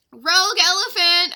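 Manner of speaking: banana seller warns everyone; loud alarmed market shout. Acted. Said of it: "a banana seller shouted".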